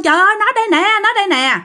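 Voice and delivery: high-pitched excited yell; a high cry of found her; shouting she's here. Retold or imitated. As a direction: call out the discovery in alarm. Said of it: "mới la lên".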